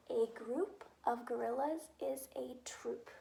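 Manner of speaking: soft whisper as she writes; whispering while writing. Shown as a demonstration. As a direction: breathe the words out while writing. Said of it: "I am going to write".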